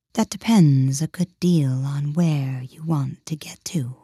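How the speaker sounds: slow, unvoiced whisper of a grinning cat; lazy and knowing, breathed out slowly; sly cat whisper. The Cheshire Cat answering Alice, slow sly whisper. The cat's held voice.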